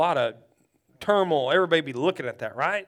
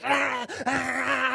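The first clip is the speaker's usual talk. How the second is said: imitated convulsing cry; 6 semitones higher, much slower than usual. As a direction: act out a fit with throaty cries. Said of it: "like that".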